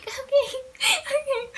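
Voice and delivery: high, tearful little squeak; scared. Retold or imitated. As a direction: squeak out a terrified okay, half laughing, half crying. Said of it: "and I'm scared, like, my whole body's shaking".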